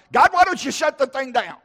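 high, loud demand; exasperated outburst to God. Retold or imitated. Preacher acting out his prayer to God, high exasperated outburst. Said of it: "I didn't say it exactly like that".

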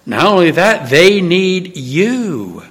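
ordinary talk, nothing marked

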